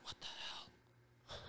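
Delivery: drawn-out stunned whisper; retold shock under the breath; whispered disbelief. Reacts to housing price in retelling.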